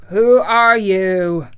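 loud, slow challenge; crying out. A narrator's reading. Tin Woodman challenges the little man, crying out. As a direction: cry it out, drawing out each word. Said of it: "rushed toward the little man and cried out".

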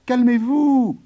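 high, pleading farmer; calm the giant down, high. Acted. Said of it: "dit Jude".

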